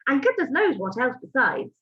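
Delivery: ordinary delivery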